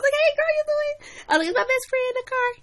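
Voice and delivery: very high, sing-song friendly voice; sweet high greeting. Retold or imitated. Girl greets best friend's mom in the parking lot, sing-song. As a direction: greet her in a bright falsetto.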